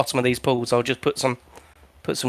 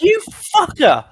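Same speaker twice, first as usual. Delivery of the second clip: "You fucker" snapped much higher and louder; hostile insult; 12 semitones higher, 5 dB louder, slower than usual. Shown as a demonstration. Same insult turned nasty, sharp high angry. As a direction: spit "You fucker" high and loud, drawn out. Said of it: "then that'd be more like".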